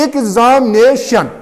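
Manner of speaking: Examination slow, in separate beats; examination as ig-zam-nay-shan, slowly parted; syllable by syllable. Shown as a demonstration. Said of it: "कितने में हमने इसको किया है".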